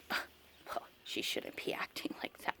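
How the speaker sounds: hushed, disapproving whisper of a shopper; mock shopper tutting under her breath; judgmental stranger whisper. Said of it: "people see her not talking".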